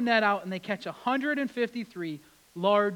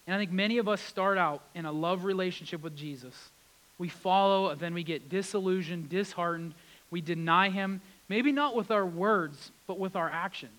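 ordinary talk

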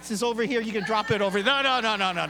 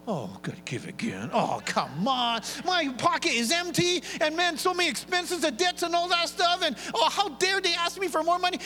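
whiny complaining giver, mostly high; grumbling reluctant giver; 10 semitones higher than usual. Retold or imitated. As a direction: moan about giving money. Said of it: "I want this church to not go".

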